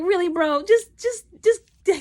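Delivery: high-pitched, stammering exasperation; worked-up, incredulous reaction; exasperated disbelief. Retold or imitated. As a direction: sputter in disbelief at a stubborn friend. Exasperated reaction to haggling in story.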